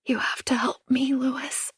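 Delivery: breathy frightened whisper. A narrator's reading. Anna whispers to Louis on the phone, frightened whisper. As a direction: whisper the plea to Louis, barely voiced. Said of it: "I whisper".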